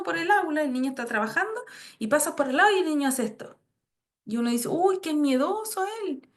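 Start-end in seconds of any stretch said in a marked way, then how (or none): 4.7-6.3 slower, higher-pitched teasing exclamation; mock remark. Adult remarking on a startled child at school, mock surprise. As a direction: draw it out, lifted in pitch, as a passing comment.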